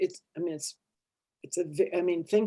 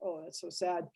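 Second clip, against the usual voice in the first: hushed, sinking let-down; quietly giving up on being heard; deflated; 14 dB quieter than usual. Acted. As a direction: drop to a small, soft voice. Acts out a cry going unanswered.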